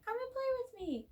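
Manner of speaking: very high, shrill put-on doll with cackle; a badly acted killer doll, squeaky high; creepy doll voice. Vlogger voices the doll threatening everyone, high and creepy. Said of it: "shit acting from that doll".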